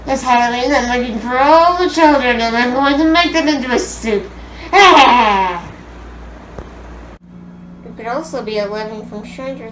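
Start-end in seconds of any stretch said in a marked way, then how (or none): 0.1-4.3 cackling witch, loud and gleeful; loud witch voice, threatening children. Acted. 4.3-7.8 loud evil cackle; witch cackle. Acted. Witch laughing after soup line.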